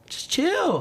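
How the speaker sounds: high, airy brush-off; high dismissive quote. Retold or imitated. Host brushing off nagging fans, high and airy. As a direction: wave it away lightly.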